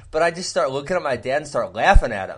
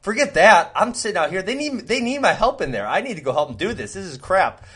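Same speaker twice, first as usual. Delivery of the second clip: raised pitch, retold huffy insistence; mock indignant protest; 4 semitones higher, 3 dB louder than usual. Banished son insists on helping his dad, mock indignant. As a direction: re-enact a worked-up kid demanding to help.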